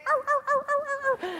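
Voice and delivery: very high comic yelps of pain; squealed, rapid ow-ow-ows; mock yelping. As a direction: yelp like someone hurt and running away. Imitating the fleeing men's yelps.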